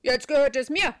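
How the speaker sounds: loud gruff terrier; barking dog voice. A narrator's reading. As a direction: bark the words like a dog. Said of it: "behauptet Charlie".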